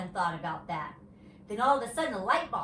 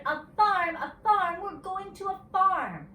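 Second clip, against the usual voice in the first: high, loud group cheer; children shouting; 11 semitones higher, 6 dB louder than usual. A narrator's reading. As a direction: shout it out as excited kids. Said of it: "shouted the children".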